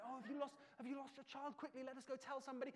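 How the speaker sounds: lightly voiced high concerned bystander; mock helpful shopper, fast; fussing strangers. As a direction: strangers offering help in a lost-child panic. Strangers joining the search.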